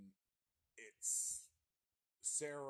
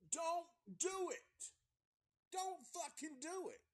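very high, drawn-out yell, slow; pleading shout; 5 dB louder, slower than usual. Acted. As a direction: beg them from the top of your voice. Pleading at townsfolk on screen.

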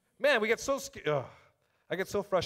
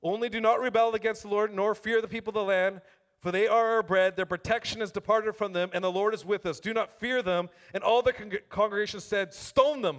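raised, ringing Bible reading to the room; scripture reading; 3 semitones higher, 5 dB louder than usual. Acted. Preacher reading the spies' report aloud to the church, proclaiming. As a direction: proclaim the verse to the congregation.

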